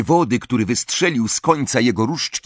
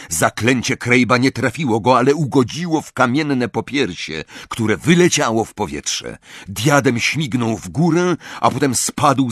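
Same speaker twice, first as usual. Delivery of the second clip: ordinary delivery, nothing marked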